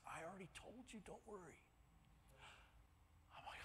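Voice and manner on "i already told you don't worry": hushed calm reminder; voice of God. Retold or imitated. Preacher voicing God reassuring him, very soft. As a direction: let God's words come very softly. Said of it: "God said".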